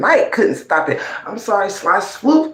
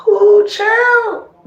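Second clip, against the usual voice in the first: shrill mock surprise, much higher and louder; high taunting exclamation; 4 dB louder than usual. Real, not acted. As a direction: cry out in mock amazement.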